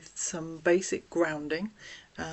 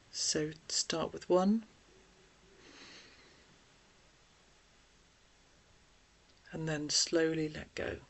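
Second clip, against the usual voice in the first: guided breathing; 4 dB quieter than usual. Acted. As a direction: gently talk them through each breath, leaving room to breathe. Leads deep breathing exercise.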